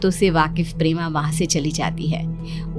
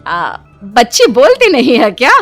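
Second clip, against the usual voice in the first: higher, louder, taken aback; surprised question; 4 semitones higher, 6 dB louder than usual. Acted. Villager learning the girl may be mute, surprised. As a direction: ask in startled disbelief.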